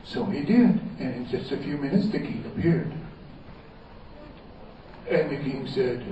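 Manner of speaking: usual speaking voice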